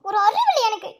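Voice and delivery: rueful laugh through the words; embarrassed laughing self-scold; laughing at herself. Acted. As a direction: laugh at your own stupidity.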